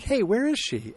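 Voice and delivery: high-pitched imitated demand; acted questioning. Friends asking after the missing British girl, high and questioning. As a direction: retell a pointed question, voice lifted high.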